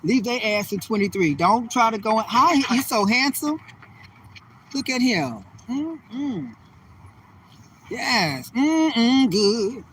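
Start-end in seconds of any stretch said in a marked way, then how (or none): 2.3-9.8 breathy-free admiring coo, playful flirting; lightly flirtatious crooning; flirty swooning. Pretend. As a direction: swoon over the handsome passer-by. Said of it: "why I'm talking like this".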